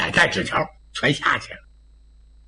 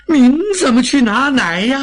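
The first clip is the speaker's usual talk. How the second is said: flustered maid; 8 semitones higher, 5 dB louder than usual. Acted. Flustered maid protests to young master. (慌张女佣拖长声音惊呼)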